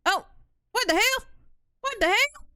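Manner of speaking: very high, loud shocked cry; real jump-scare yelp; startled shriek. Real, not acted. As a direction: shriek it in sudden alarm. Startled by slug in kitchen.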